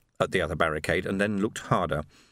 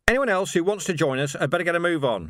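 mild, high-pitched call to a crowd; shouted call; 7 semitones higher than usual. A narrator's reading. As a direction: call out without full shouting. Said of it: "he shouted".

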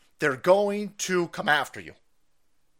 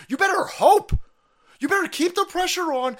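higher, louder warning shout; 11 semitones higher, 5 dB louder than usual. Real, not acted. Warning viewers about the boycott, shouted. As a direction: yell it as a real warning. Ranting warning to his audience.

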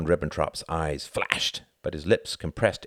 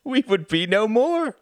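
much higher, louder scornful voice; mocking envoy; 6 dB louder than usual. A narrator's reading. Envoy mocking Churchill, high and scornful. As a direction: scoff at him. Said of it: "Von Ribbentrop laughed".

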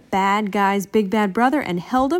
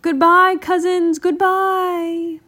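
high, drawn-out calling; loud singsong farewell shout; 9 semitones higher, 6 dB louder, slower than usual. A narrator's reading. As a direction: call goodbye across a distance. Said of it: "Bill and Pete shouted".

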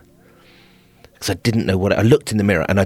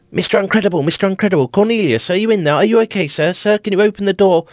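much higher, louder worried calling; calling through a door; 12 semitones higher, 4 dB louder, faster than usual. Retold or imitated. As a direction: staff calling through the locked door, concerned. Staff calling through the toilet door.

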